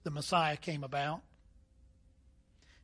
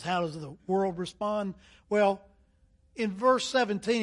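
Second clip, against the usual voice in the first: ordinary delivery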